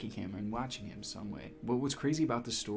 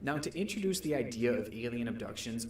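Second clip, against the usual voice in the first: ordinary delivery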